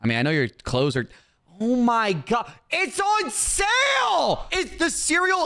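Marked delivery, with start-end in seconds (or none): 2.7-5.5 high, slow drawn-out shout; exclaiming. Acted. Shopkeeper yells at a broke customer, high and drawn out. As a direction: stretch out sale like a scolding shout.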